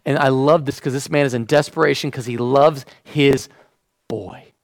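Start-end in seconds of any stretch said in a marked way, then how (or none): none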